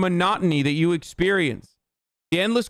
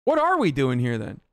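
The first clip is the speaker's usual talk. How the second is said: lower, resigned and flat; deflated repeat; 3 semitones lower than usual. Real, not acted. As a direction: let the anger drain out.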